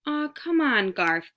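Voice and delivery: high, wheedling coax. A narrator's reading. Mouse coaxes Garf to let him out, wheedling. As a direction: sweet-talk him into it. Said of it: "coaxed Ralph".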